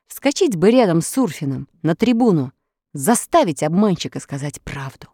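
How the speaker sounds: ordinary delivery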